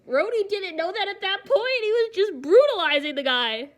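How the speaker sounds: high, loud animated defense; excited. Real, not acted. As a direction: get worked up about it.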